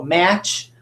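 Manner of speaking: "match" with short A, as in cat. Shown as a demonstration. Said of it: "makes either its short or its third".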